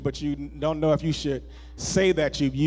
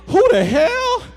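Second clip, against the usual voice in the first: high, loud startled outburst; shocked yelp at a stranger; startled who the hell; 9 dB louder, slower than usual. Shown as a demonstration. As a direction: snap it at a stranger who runs up. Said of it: "when somebody run up on you that you don't know".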